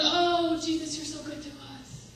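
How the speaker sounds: sugary mock devotion, jumping high on the "Oh"; mock gushing worship. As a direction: parody the churchgoers' showy praise. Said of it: "everybody else going".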